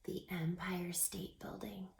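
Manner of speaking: hushed, breathless whisper; awed whisper to the kids. Acted. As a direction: whisper it like a secret wonder. Awed reveal to children.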